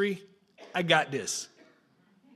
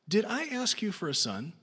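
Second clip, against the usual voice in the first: softer, aching question; hurt, softer; 6 dB quieter than usual. Acted. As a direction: let her heartbreak show quietly. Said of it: "Can you hear that in her voice, in her heart".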